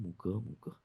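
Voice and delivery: a hushed trailing echo; hushed refrain fading into silence. Acted. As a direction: fade the words मुकं, मुकं to near silence. Poem refrain echoed.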